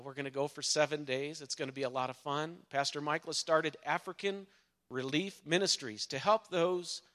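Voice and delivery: normal talking voice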